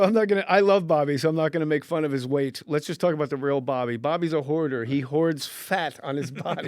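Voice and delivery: quoting his roast set, loud; roast joke delivery. Retold or imitated. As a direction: sell the joke to the roast crowd. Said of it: "You go".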